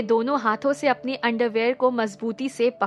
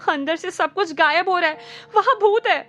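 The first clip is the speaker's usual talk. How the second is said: nervously; 5 semitones higher than usual. A narrator's reading. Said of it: "घबराते हुए कहा".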